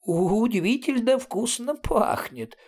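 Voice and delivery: high, slow, admiring bleat; delighted bleating. A narrator's reading. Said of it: "восхищённо проблеял профессор Айхохо". (восхищённое высокое блеяние)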